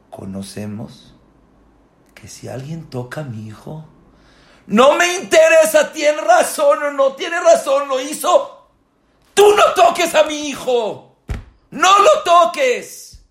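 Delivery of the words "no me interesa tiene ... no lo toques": high, loud fatherly shout; impassioned preaching. Acted. Rabbi voicing a parent defending his son, loud shout.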